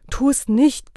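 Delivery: high, urgent plea from the wife; wife imploring her husband; pleading. A narrator's reading. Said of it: "bat sie".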